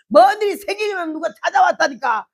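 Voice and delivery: wife yelling back at her husband; retold yell. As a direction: shout back at the puzzled husband. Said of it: "근데 왜 이렇게 소리 질러?".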